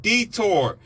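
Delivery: high, loud shouts of warning, pulling up over and over; shouted warnings. Retold or imitated. Warning listeners before the crash, shouting high and loud. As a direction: yell it like a panicked co-pilot. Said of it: "I said".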